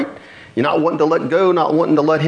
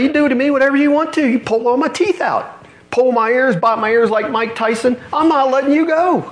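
desperate Jacob; 9 semitones higher than usual. Retold or imitated.

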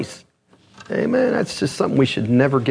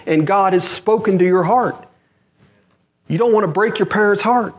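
high-pitched pulpit warning; impassioned preaching; 9 semitones higher than usual. Real, not acted. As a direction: warn the congregation with urgent conviction. Preacher warns young listeners.

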